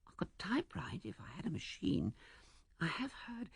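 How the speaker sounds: high, soft, whispery voice; small airy female voice; practical young woman. A narrator's reading. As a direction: offer the suggestion in a light hushed voice. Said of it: "still practical, making the suggestion arithmetical".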